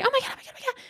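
very high giddy gushing; high-pitched mock freak-out; imitated excited squeal. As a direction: squeak it out like a thrilled friend. Host reacts to the number exchange.

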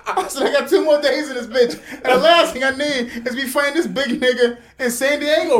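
loud, high, laughing plea; retold exasperation. Customer retells begging salon lady to hurry, loud exasperated plea. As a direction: plead in comic desperation, voice way up.

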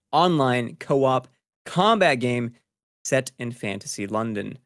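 ordinary talk, nothing marked

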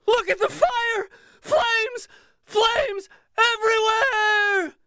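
shrieking high and loud, drawn out; frenzied screaming. A narrator's reading. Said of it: "crying out more loudly than ever".

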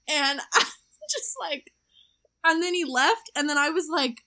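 laughing. Real, not acted. Laughing recalling crush.

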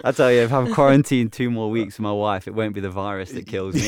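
laughing through a joke; cracking up while joking. Real, not acted. Joking about wife in quarantine.

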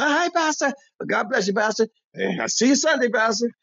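high, syrupy churchy greeting; fawning. Retold or imitated. Regulars sucking up to the pastor with high sugary voices. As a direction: lay the piety on thick.

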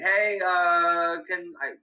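mild mock call out a window. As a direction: imitate calling down to another parent. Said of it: "I call out the window".

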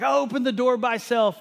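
put-on high, loud toddler voice; defiant toddler. Daughter at the front door, high proud toddler voice. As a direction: chirp it like a proud little girl. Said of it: "she's like".

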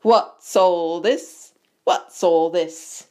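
loud, slow, drawn-out question; booming. A narrator's reading. Teacher interrupts gathered pupils, booming. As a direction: announce your arrival grandly.

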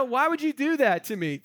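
hammed-up hurt protest; mock complaint. Paul protesting to his weeping friends, high mock complaint. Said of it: "is what he's saying".

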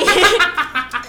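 high burst of laughter; amused laugh. Real, not acted. Host reacting to a joke about Duarte, amused laugh. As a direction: laugh out loud at the teasing.

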